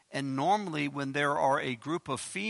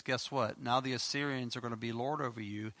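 usual speaking voice

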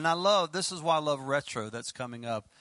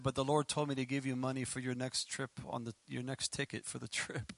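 usual speaking voice

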